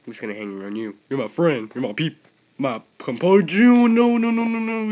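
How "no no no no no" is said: high, very loud string of no's; panicked screaming no's. Real, not acted. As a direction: shriek as the big ones close in. Sees huge balls approaching.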